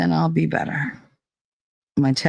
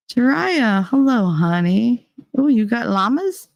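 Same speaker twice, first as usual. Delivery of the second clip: pet talk; 7 semitones higher than usual. Real, not acted. Greeting a pet on stream.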